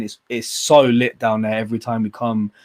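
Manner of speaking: ordinary talk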